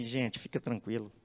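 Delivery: low, soft and unhurried reassurance; calm Moses. Acted. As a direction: settle a panicking crowd gently. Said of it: "Moisés tranquilamente".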